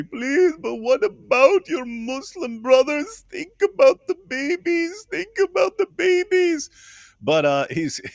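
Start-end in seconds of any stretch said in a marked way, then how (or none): 0.0-6.7 put-on high, slow, whiny pleading in a silly accent; mocking pleading activist. Podcaster mocks an activist begging Egyptian soldiers, in a high whiny silly accent. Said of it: "the stupidest accent ever".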